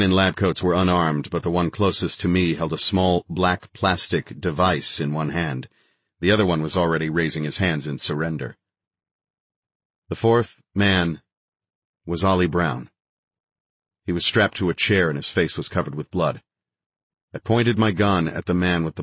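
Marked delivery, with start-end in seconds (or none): none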